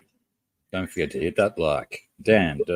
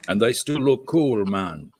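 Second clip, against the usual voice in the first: higher-pitched imitation of an African accent; mock African accent, pitched up; feeble African accent; 5 semitones higher, 3 dB louder than usual. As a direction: attempt a young African man's accent, badly. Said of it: "My feeble attempt at an accent".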